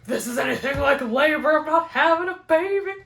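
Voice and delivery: high, loud wail building upward; hammed-up scream, climbing in pitch and volume; mock screaming. Cramping runner crying out on the course, mock screaming. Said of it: "I'm, like, screaming".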